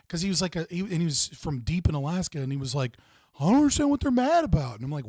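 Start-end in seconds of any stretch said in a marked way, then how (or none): 3.4-4.8 imitating a baffled guy, pitched higher and louder; confused army buddy. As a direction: play the clueless recruit, puzzled and innocent. Retelling Alaska army buddy's words.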